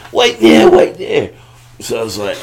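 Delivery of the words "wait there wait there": calling out. Retold or imitated. Lance calling out.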